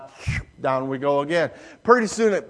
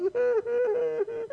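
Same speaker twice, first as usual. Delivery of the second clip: imitated child whimper; 7 dB quieter than usual. Daughter frightened atop slide.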